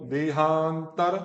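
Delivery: देहांतर slow, syllable by syllable; broken up. Shown as a demonstration. Teacher telling student to say देहांतर broken up. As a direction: break देहांतर into pieces slowly. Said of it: "आप तोड़ तोड़ के बोलो".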